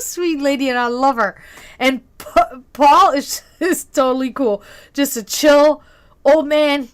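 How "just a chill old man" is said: usual speaking voice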